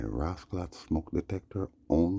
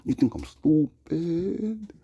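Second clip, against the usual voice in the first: much higher and slow, stretching out "stupid"; higher drawn-out mock outrage; high, slow put-on voice; 13 semitones higher, 4 dB louder, much slower than usual. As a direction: squeak it out slowly in disbelief. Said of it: "I'm like".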